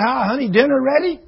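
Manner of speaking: chirpy, high, carefree hello; breezy husband greeting. Retold or imitated. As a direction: play the clueless husband breezing in. Husband breezes home after months.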